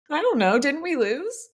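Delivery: high little-kid voice, lightly mock upset; imitating tearful child. Five-year-old to mom after dad lost, mock tearful voice. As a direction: pitch it up like a confused, crying child. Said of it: "Tyler started crying".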